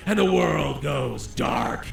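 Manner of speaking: low, very slow hammed-up doom; over-exaggerated campy narration. Reading out the player's blackout, campy and over-exaggerated. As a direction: drop low and stretch it out theatrically. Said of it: "over-exaggerated dialogue".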